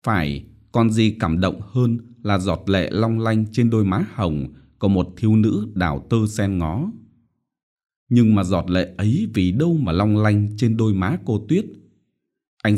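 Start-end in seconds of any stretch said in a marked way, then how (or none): none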